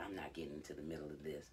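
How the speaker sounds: low, soft, quick refusal; low grandma. Retold or imitated. Grandma staying out of the fight, low and quiet. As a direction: drop right down, staying out of it.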